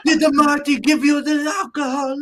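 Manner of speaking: high, loud mock Iranian accent; playful Sheik accent, dropping extra "the"; Iron Sheik impression. Imitating the Sheik's broken English.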